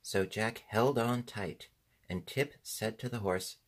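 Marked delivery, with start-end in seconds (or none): none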